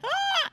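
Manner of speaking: sudden high, loud gasp; startled, sharp gasp of starstruck shock; re-enacted gasp, high and sudden; freaked-out gasp. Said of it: "completely freaked out. I was like".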